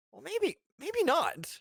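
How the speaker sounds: high, slow, unsure musing; doubtful. Retold or imitated. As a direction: drag it out, doubting. Retold audience doubt.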